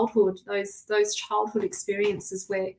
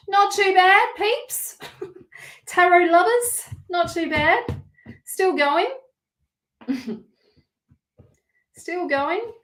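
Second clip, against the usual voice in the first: playful sing-song; 10 semitones higher, 3 dB louder, slower than usual. Real, not acted.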